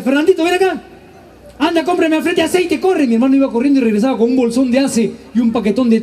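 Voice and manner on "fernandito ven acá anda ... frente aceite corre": higher mom voice calling out; mock mother sending him on an errand; mother calling son. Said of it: "lo llamaba y decía". (voz de mamá llamando al hijo)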